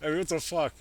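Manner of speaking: usual speaking voice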